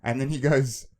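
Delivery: ordinary talk, nothing marked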